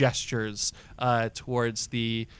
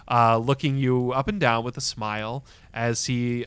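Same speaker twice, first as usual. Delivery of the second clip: ordinary talk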